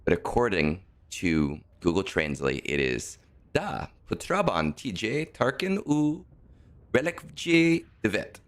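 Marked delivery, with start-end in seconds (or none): 3.5-8.4 halting, higher-pitched attempt at Serbian; clumsy Serbian read from a translation. Shown as a demonstration. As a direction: sound out unfamiliar Serbian words slowly, unsure. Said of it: "have no idea how to pronounce words in Serbian".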